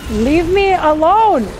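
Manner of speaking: drawn-out loud whine; exasperated plea. Real, not acted. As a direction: beg to be left alone, fed up. Enemy keeps attacking.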